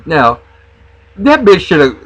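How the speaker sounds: usual speaking voice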